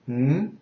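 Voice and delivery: HMM rising like a question; second tone. Shown as a demonstration. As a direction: lift the pitch through HMM. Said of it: "The second is".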